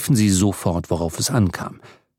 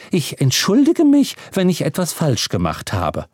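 dignified housekeeper; 6 semitones higher than usual. Acted. Housekeeper speaks, voiced higher.

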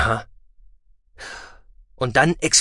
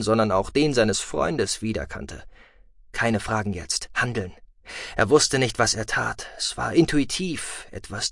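ordinary delivery

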